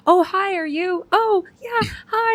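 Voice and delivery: high, slow, put-on sweet hello; mock first-date greeting. Wife acting out meeting her husband at the bar, high and sweet. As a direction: play the giddy stranger on a fake first date. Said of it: "and we do, like".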